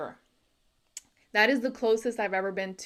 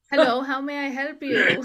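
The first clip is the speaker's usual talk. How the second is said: IT helpdesk agent; 5 semitones higher, 5 dB louder than usual. Acted. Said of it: "I called I T".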